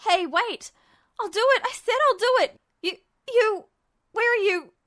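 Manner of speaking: high, loud frantic pleas; desperate shouting. Acted. Ed shouts after Justin, frantic. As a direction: beg him to come back. Ed begging him to stay.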